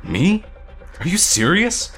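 high, loud, incredulous questions; offended, disbelieving outburst; indignant. A narrator's reading. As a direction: throw the blame back. Noah rounds on Parker in quarrel.

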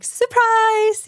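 high, loud mock "Surprise!" yell; surprise shout. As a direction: jump out and shout it as a crowd of friends. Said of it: "jumping out and being like".

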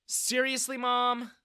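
high-pitched daughter's complaint; exasperated sigh. A narrator's reading. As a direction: sigh at your mother's foolishness. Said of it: "Bella sighed".

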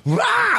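slow drawn-out mock battle cry; warlord roar. Imitating the little boy playing full warlord, a slow mock war roar. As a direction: roar it like a tiny warlord charging. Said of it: "like, full warlord".

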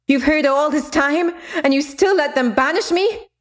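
high, raw accusation; rage. Acted. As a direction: accuse him through rage. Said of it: "clouded her with rage".

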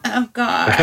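normal talking voice

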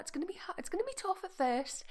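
high-pitched mock sympathy; coaxing reassurance. Driver placating the people beeping, high mock coaxing.

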